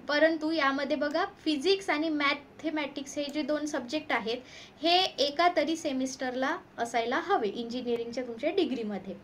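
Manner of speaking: ordinary talk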